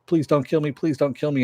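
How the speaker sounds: high pleading plea for mercy; begging. Retold or imitated. Soldier begging David not to kill him, high pleading.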